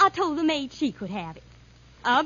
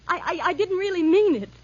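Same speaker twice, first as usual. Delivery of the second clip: higher, louder stammered retraction; desperate pleading; 4 semitones higher, 5 dB louder than usual. Acted. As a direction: plead urgently, voice rising. Pleads as threat grows.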